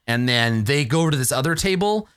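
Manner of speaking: ordinary delivery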